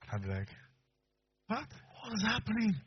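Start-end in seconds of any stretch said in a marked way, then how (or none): 1.5-2.7 high, slow bewildered question; baffled husband; alarmed husband. Retold or imitated. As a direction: ask it drawn out in disbelief.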